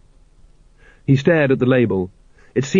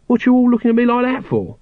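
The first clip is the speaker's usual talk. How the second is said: pitched up defensive whine; sullen; 12 semitones higher than usual. A narrator's reading. Nobby snapping at the staring watchmen, sullen. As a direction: get huffy at the stares. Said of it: "added sullenly".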